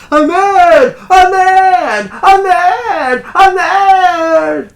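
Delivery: high, loud madman's scream; crazed shouting. Acted. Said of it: "[name] shouts".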